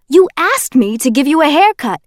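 loud defensive protest; Kenji, protesting. Acted. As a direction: backpedal in a hurry.

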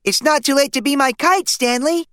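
put-on sing-song tease from a little kid; little brother. A narrator's reading. Little brother Arthur dressed as Franklin teases Stanley, high childish voice.

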